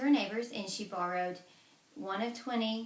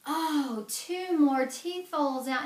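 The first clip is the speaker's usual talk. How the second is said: higher, louder dismayed wail; pained cry; 7 semitones higher, 7 dB louder, slower than usual. Acted. Giant losing more teeth, wailing high and loud.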